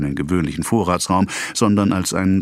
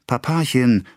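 a hint of a small daughter tugging, higher; pleading child; 6 semitones higher, slower than usual. A narrator's reading. Little daughter tugging her father's coat, mildly pleading. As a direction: coax him gently. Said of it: "Athalie zupfte ihn am Rock".

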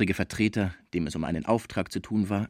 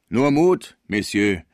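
hearty call; 7 dB louder, slower than usual. A narrator's reading. Sauvage urges, loud and far.

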